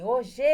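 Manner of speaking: "O G" called out high and loud, weight on the last syllable; wrongly stressed call. Retold or imitated. Teacher imitating a pupil calling hoje, last syllable punched high. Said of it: "Aí o rapaz fez assim".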